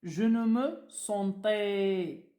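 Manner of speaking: je ne me sentais said very slowly, word by word; slow dictation. Shown as a demonstration. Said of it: "Donc, on dit".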